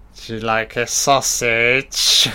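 slow, hammed-up suggestive offer; slow comic innuendo; Mister Wonderful. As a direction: draw it out in a put-on voice, rising at the end. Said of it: "I'm Mister Wonderful".

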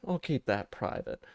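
softly, under the breath. Real, not acted. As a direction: mutter it again to yourself. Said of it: "repeated quieter".

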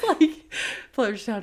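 ordinary talk

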